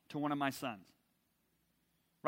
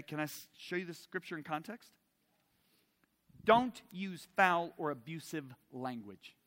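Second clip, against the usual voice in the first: ordinary talk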